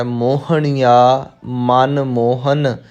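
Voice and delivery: slow, spaced words, ਮੋਹਨ ending in plain "n"; careful reading. Shown as a demonstration. Teacher giving learners the reading, slow ਮੋਹਨ with plain "n". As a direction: recite slowly, retroflex n in ਮੋਹਣੀਆ, dental n in ਮੋਹਨ. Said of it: "ਇੱਥੇ ਜੋ ਪਾਠ ਆਪਾਂ ਕਰਨਾ ਹੈ".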